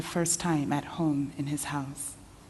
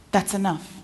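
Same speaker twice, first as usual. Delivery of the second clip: firm; 5 semitones higher, 5 dB louder than usual. Acted. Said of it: "he says softly".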